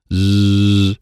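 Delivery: long droning buzz; buzzing noise. A narrator's reading. Child interrupted by buzzing cards, imitated buzz. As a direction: hold a steady voiced zzz.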